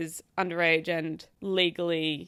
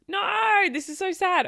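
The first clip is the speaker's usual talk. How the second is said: higher, near-cry no; crying out upset; 10 semitones higher, 3 dB louder, slower than usual. Real, not acted. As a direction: cry out in dismay. Upset by the story.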